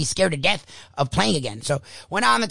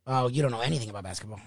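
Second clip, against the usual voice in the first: quick, low, muttered brush-off of a know-it-all; mock dismissive parent; 7 semitones lower, 8 dB quieter, faster than usual. Coach mimics a parent's dismissive retort, fast and low. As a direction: sneer it fast and low under your breath.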